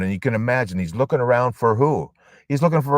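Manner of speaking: ordinary talk, nothing marked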